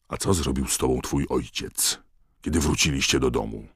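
usual speaking voice

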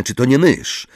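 plain reading voice

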